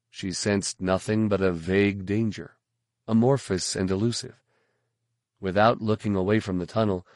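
plain reading voice

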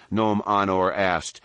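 usual speaking voice

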